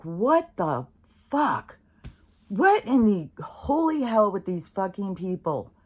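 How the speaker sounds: stretched, pitch jumps high on fuck; genuinely appalled, spit it out; outraged disbelief. Real, not acted. Outraged at alligator in river.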